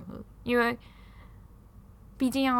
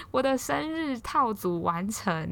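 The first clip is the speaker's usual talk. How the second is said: slow, savoring; 5 semitones higher, much slower than usual. Real, not acted. Savoring the complete gift set.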